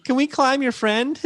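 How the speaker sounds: squeaky eager small person; high mock fan voice. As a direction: pipe up like a tiny fan. Voicing fans in Japan.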